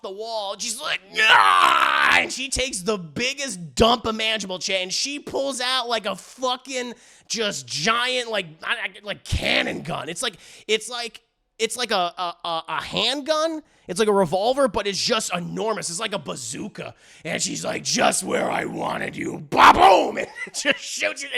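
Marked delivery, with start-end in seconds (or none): 1.2-2.2 much lower loud roar; a deep loud attack roar; low battle roar. Retold or imitated. Mommy flips off the wall. 17.8-19.4 much lower, slow, breathy menace; a low gritty one-liner; villainous growl. Retold or imitated. Mommy aims the giant cannon. 19.5-20.2 loud high explosion noise; a loud mouth-made boom; cannon blast. Retold or imitated. Cannon fires.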